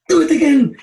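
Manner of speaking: loud, very high retold shout; excited demand. Friends demanding an encore, excited shout. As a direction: call out for an encore, pitched right up.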